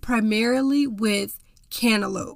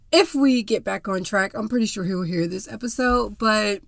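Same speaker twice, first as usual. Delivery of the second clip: ordinary delivery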